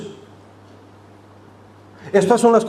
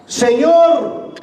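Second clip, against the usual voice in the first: awed reply; 7 semitones higher than usual. Acted. Paul answers the voice in awe.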